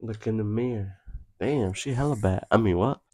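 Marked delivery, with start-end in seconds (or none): none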